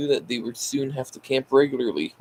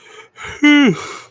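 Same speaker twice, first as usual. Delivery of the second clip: loud weary sigh; 11 dB louder than usual. Real, not acted. Tired reader sighs loudly.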